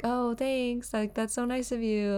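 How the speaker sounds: high, soft polite shop voice; sweet customer-facing politeness; customer service voice. Retold or imitated. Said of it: "That's my fake voice".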